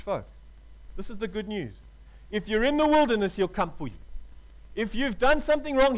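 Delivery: ordinary delivery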